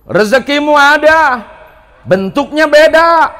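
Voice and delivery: loud, rising sermon delivery; preacher exhorting the congregation to stop complaining; full-force preaching. Acted. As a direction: drive each question home to the crowd. Preaching to the crowd.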